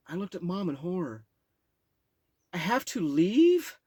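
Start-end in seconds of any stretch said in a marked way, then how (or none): 2.5-3.8 put-on high, loud, slow pleading child; young child pleading. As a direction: beg like a shocked little kid. Child self pleading to mom.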